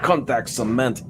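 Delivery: put-on Russian accent slipping into Spanish. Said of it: "Now he's Spanish".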